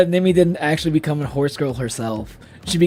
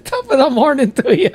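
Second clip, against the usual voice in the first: high, hammed-up Irish accent; Jacksepticeye; 8 semitones higher than usual. Drunk streamer imitating an Irish YouTuber, mock Irish accent. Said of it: "I was trying to do Jacksepticeye".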